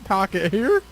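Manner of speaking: animated folksy Southern complaint; Southern accent. Acted. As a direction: put on a Southern twang, climbing toward the end. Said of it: "we're back to Southern".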